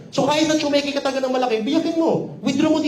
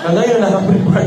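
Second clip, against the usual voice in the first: louder, fast laughing repeat; 2 semitones lower, 7 dB louder, faster than usual. Real, not acted. Speaker teasing audience member, louder fast laughing repeat. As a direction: laugh through the teasing line. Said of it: "repeated laughing".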